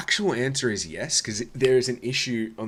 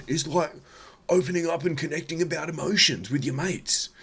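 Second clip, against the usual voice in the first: mock sensitive Aussie bloke; 2 semitones higher, 3 dB louder than usual. Mocking a sensitive Aussie bloke urging his mates to share emotions, eager. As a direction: gush about feelings like a caricature.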